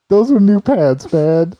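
patient protesting to paramedics about his cut pants; outraged complaint. Retold or imitated. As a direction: complain loudly like it's a tragedy.

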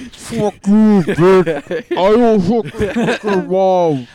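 loud, slow, high trash talk; Call of Duty troll. Retold or imitated. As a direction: yell insults like a lobby troll. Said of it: "I did a little bit of trolling".

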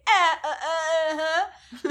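high, drawn-out, laughing stammer of assent; mock upset agreement. Podcast guest replaying her reply to criticism, mock upset agreement. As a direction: fake going along through a strangled laugh.

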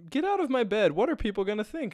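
high, loud mock-shocked lady; indignant woman. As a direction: squeal it like a scandalized matron. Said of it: "then she's like".

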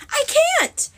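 very high, panicked protest; desperate protest. A narrator's reading. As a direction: blurt it out in a high squeal, cornered. Sorceress cornered at knifepoint, protesting.

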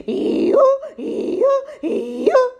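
high, loud rising hee-haw bray; high donkey braying. Acted. Animals charge the robbers.

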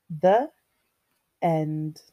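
usual speaking voice

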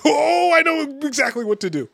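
high, loud, triumphant boast; hammed-up overconfident crisis manager; cocky crisis guy. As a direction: shout it like the hero who planned for this. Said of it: "and you're like".

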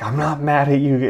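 mock yell, high and loud; imitated yelling. Imitating his boss yelling an apology to the crew, high loud mock yell. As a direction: yell it while insisting otherwise. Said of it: "You're still yelling".

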